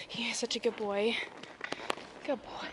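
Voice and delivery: ordinary talk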